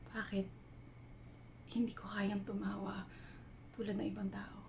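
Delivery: soft, hushed introspection with long pauses; half-whispered, searching confession, left to hang between thoughts; reflective dramatic monologue. Acted. Woman reflecting on life's purpose while climbing a mountain at night, hushed monologue.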